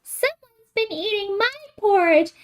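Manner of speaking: put-on high, crying Baby Bear voice; wee little voice. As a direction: whimper it like a tiny bear cub. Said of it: "in his wee little voice".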